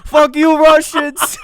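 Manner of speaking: high furious shout at the Russians; angry yell. Acted. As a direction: scream it like an enraged rink worker. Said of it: "A guy on a Zamboni yelling".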